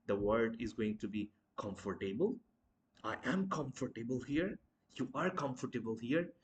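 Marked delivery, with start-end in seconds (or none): 1.6-6.3 comfortable split as "com-for-tay-bul"; mispronounced comfortable. Shown as a demonstration. Teacher acting the mistake, comfortable in four full syllables. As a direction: sound every syllable of comfortable.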